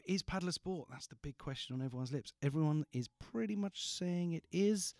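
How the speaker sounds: ordinary delivery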